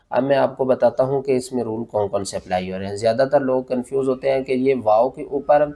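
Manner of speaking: ordinary talk, nothing marked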